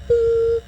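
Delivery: one high, level monitor tone; a sustained high-pitched boop; imitated flatline beep. Patient mimics the monitor as she codes, one high beep.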